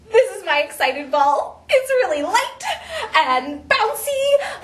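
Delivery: giggly, high-pitched bouncy delight; acted excitement. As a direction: bubble over, stretching the last word playfully. Introduces excited ball, acting excited.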